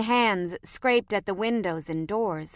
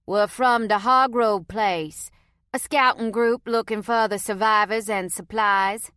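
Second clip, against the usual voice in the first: put-on Cajun accent; 3 semitones higher, 6 dB louder than usual. A narrator's reading. Cajun scout explains his group in Cajun accent. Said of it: "in a heavy Cajun accent".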